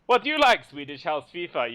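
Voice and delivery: badly faked German accent; attempted German accent. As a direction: put on a clumsy German accent. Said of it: "that was my attempt at a German accent".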